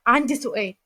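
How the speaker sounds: usual speaking voice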